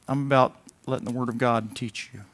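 ordinary delivery

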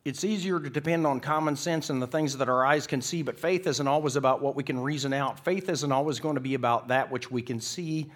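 loud, emphatic pulpit voice; preacher in full flow; impassioned preaching. Real, not acted. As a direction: press the point home forcefully.

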